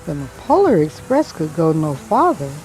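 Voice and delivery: plain reading voice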